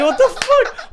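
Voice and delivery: very high, loud incredulous laugh; squealed mock disbelief through laughter. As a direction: blurt it in mock outrage, cracking up. Retells disbelief at guest, laughing.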